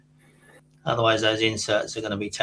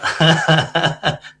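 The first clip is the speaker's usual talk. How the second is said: laughing; 7 semitones higher, 8 dB louder than usual. Real, not acted. Laughs at his own worry.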